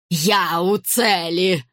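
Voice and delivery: exultant crone, very drawn-out; леди Мортимер. A narrator's reading. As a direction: cry it out slowly in triumph. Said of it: "ликующе воскликнула старуха". (ликующий протяжный возглас старухи)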